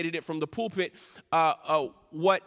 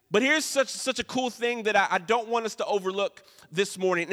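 normal talking voice

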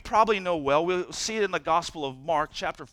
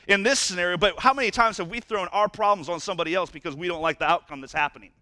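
ordinary talk, nothing marked

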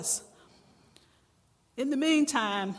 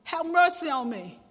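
crying out for mercy; 4 semitones higher, 7 dB louder than usual. A narrator's reading. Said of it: "she's continually crying".

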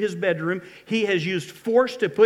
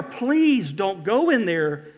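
slow pleading warning; 3 semitones higher, 5 dB louder, much slower than usual. Retold or imitated. Congregation warned Tamar, slow and pleading. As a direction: draw it out slowly, begging. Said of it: "you want to yell out to Tamar".

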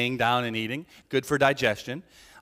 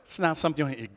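ordinary talk, nothing marked

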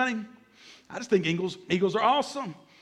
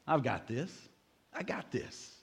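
low, hushed cool confidence; outward self-assurance; 7 semitones lower, 12 dB quieter than usual. Acted. Preacher voices what everybody says outside, low quiet assurance. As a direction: toss it off low and very soft. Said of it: "On the outside, everybody's saying".

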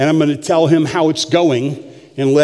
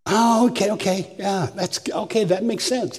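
put-on young man agreeing; 5 semitones higher, 5 dB quieter than usual. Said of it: "he says".

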